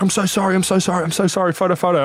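high-pitched mock pleading; imitated begging, pitched well up; apologetic fan. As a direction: gush repeated apologies like a pushy fan. Mimics the fan begging for photo.

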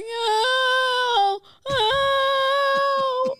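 high, drawn-out cry of pain; mock pained wail. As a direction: imitate a kid wailing while tumbling. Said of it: "I'm going".

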